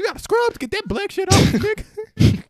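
high, hammed-up bigot voice. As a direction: sneer it in a high mock voice.

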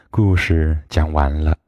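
ordinary delivery

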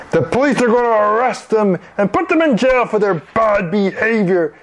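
high-pitched, scolding official; irate doorman scolding kids; angry threat. Acted. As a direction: threaten them with arrest indignantly. Fat man threatens arrest.

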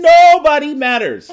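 high, loud, drawn-out declaration; mock-final verdict, stretched and booming out; hammed-up proclamation. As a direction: proclaim it slowly at full volume. Climax of acted-out verdict.

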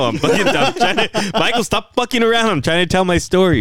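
half-laughing complaint at a friend; can't keep a straight face. Real, not acted. Laughing while scolding interrupter.

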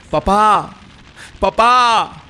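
put-on high, loud panicked child calling his father; child's desperate cries. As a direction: cry out for your dad from the fire. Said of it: "gritaba ese niño". (niño gritando desesperado)

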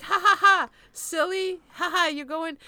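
high, loud hammed-up "ha ha, silly"; mock crowd laughter. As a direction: play the amused line of shoppers. Voicing the delighted crowd.

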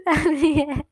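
slow laugh mixed with a cry; cracking up while saying it. Real, not acted. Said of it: "मेरे को अभी तो हंसी आ रही है". (हँसते-हँसते बोलना)

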